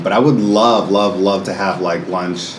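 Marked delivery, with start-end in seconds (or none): none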